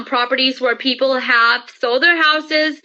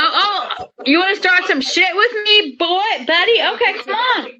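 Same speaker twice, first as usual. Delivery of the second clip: high, hammed-up taunt with a laugh; mock tough challenge; 7 semitones higher than usual. Wife acts out squaring up to husband, mock tough. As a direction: dare someone to fight, half joking. Acting out picking a fight.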